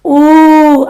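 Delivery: a loud, held u from the chest; chest u vowel. Shown as a demonstration. Coach leading the exercise, loud chest vowel. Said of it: "haz lo siguiente".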